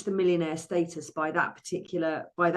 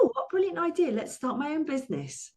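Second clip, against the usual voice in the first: sarcastic; 9 semitones higher than usual. Retold or imitated. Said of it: "And I thought".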